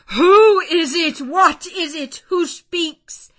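high, loud desperate cry; frantic demand. A narrator's reading. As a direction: cry out wildly for an answer. Blind man desperately demands the speaker.

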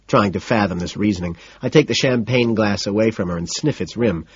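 plain reading voice

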